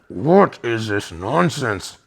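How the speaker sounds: a touch of the guru's fury; retold stern rebuke.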